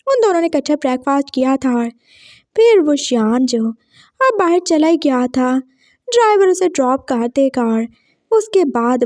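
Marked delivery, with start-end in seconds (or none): none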